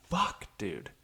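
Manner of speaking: ordinary delivery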